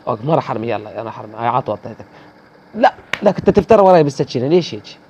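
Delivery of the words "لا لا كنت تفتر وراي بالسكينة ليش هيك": higher, louder outraged protest; complaining loudly about being stabbed from behind; indignant outcry. Real, not acted. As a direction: call out the cheap knife kill. Outrage at knife kill from behind.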